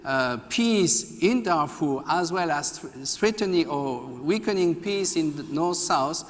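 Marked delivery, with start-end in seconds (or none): none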